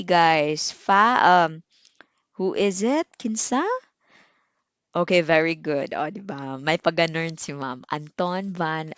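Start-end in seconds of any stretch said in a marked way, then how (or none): none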